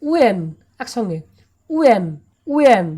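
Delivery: উএন said loudly as one oo-en glide, no h; loud model pronunciation. Shown as a demonstration. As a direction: call out উএন, no হো sound. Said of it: "উচ্চারণটা কী হবে?". (জোরে উএন, হ ছাড়া)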